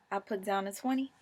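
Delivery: ordinary talk, nothing marked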